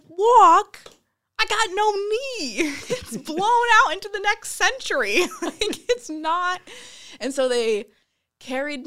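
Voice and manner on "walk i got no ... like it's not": high, loud, disbelieving with laughs; incredulous laughing. Retold or imitated. Mocking the staff who asked her to walk, high and laughing. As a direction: scoff that walking is impossible, high and laughing. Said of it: "I'm like".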